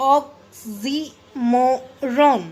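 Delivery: oxymoron in four slow separate beats; syllable by syllable. Shown as a demonstration. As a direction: break oxymoron into ok-si-mo-ron with clear gaps. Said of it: "four times आप अलग-अलग syllable".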